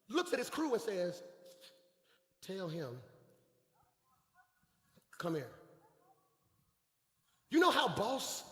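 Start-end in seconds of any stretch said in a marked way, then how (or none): 2.4-5.5 low, soft, unhurried instruction; calm authority, voice dropped right down; quiet summons. Acted. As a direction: murmur it to your crew. Acts Jesus quietly summoning blind man.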